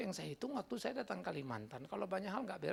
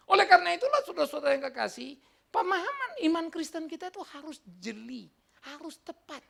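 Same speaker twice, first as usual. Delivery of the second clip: high, loud, urgent pulpit voice; forceful preaching; 8 semitones higher, 11 dB louder than usual. Real, not acted.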